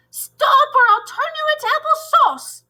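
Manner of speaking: shrieking witchy teacher. Acted. Teacher buried by apples, shrieking threat.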